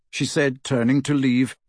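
ordinary delivery, nothing marked

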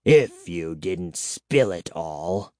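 slow, low-pitched vampire reproach; bitter. A narrator's reading. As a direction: blame them bitterly, word by word. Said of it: "he said bitterly".

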